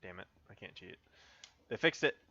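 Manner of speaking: ordinary talk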